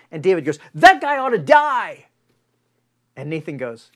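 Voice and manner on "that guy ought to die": loud, high furious verdict; David's indignant shout at the thief; outraged king. Retold or imitated. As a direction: roar out the death sentence in outrage. King angrily condemns the thief.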